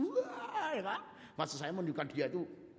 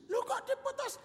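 mock hurt girlfriend. Girl confronts boyfriend about breakup.

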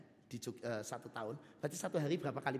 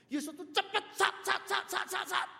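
swift whoosh sounds; 7 dB louder than usual. Acted. Imitates swift movement.

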